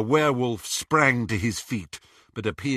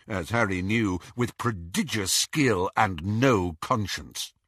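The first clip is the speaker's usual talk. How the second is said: ordinary delivery, nothing marked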